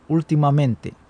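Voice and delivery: ordinary delivery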